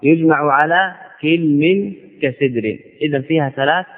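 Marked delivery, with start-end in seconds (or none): 1.2-1.9 كلم as kil-min; slow, two-syllable كلم: kil-min; kasra on the kaf. Shown as a demonstration. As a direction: say كلم with i after kaf and vowelless lam. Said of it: "بكسر الكاف مع سكون اللام".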